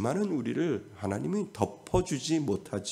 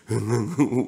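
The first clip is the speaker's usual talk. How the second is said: chuckle; 5 dB louder than usual. Real, not acted. Preacher chuckling while telling the congregation. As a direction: chuckle at your own story. Short laugh mid-sentence.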